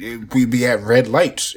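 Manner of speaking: normal talking voice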